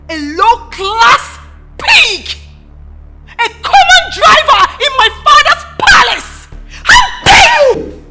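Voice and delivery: high, loud, slow insults; furious outburst. Acted. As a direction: spit the insults with royal contempt. Princess insults the driver.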